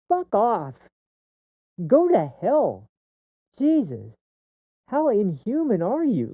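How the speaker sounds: high-pitched, indignant tirade; real outrage. Real, not acted. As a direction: lash out in genuine disgust. Real rant at callous people.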